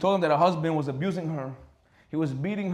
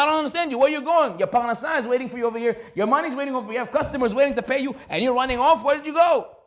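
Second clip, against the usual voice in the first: higher, louder indignant demands; angry scolding; 7 semitones higher, 4 dB louder than usual. Acted. Doctor berating the butcher for closing shop, angry and loud. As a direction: confront the butcher who ran off.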